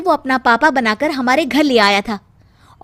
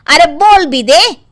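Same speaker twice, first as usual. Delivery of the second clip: loud urging; 3 semitones higher, 7 dB louder, slower than usual. Acted. Mother-in-law urges Meena to speak.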